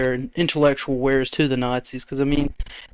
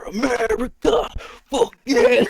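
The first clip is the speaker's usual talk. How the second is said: high, strained 'America, fuck yeah' parody voice; jokey squeezed patriotic battle cry; mock patriot; 10 semitones higher, slower than usual. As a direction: ham up a patriotic cheer, pitched way up. Hammed-up patriotic catchphrase.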